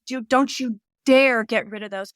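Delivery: ordinary delivery, nothing marked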